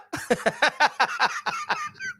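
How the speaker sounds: a very high, rising squeal of laughter. Real, not acted. Streamer squealing at his own Mayweather joke, high laugh.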